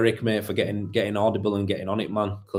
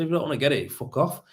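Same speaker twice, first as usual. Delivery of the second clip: mild retold anger; 6 semitones higher, faster than usual. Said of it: "And I said".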